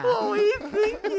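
laughing. Real, not acted. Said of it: "echoing friend, laughing".

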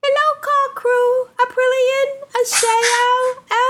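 squeaky high sucked-helium voice. Pretend. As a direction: read it like you just inhaled helium. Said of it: "to be read in a high-pitched aluminum hel-, helium voice".

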